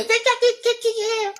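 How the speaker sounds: squeaky falsetto mimicry of critics; high-pitched mocking quote; mocking imitation. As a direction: parrot them in a very high mock voice. Mocking opponents' talk about hair.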